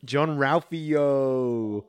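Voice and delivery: long drawn-out excited holler; excited exclamation. Real, not acted. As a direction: sing out the name, stretching it right out. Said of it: "excited exclamation of".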